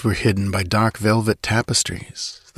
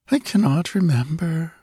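put-on sleepy muttering girl; 8 semitones higher than usual. A narrator's reading. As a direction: mumble it half-asleep. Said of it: "she murmured".

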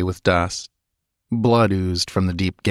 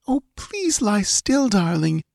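tender pleading; 13 semitones higher than usual. Acted. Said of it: "begged the girl".

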